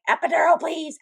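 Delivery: high, loud mock begging for pain relief; retold urgent plea. Mother retelling her first labor, urgent plea. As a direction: pipe up high, pleading in retelling.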